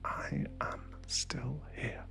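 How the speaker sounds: slow, very soft whisper; fragile whisper. Shown as a demonstration. Showing the listener how to say they survived, slow whisper. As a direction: whisper it slowly, word by word. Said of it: "whisper it, even if it cracks".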